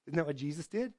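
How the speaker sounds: normal talking voice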